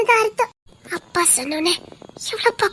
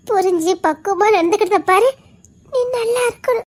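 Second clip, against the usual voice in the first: ordinary delivery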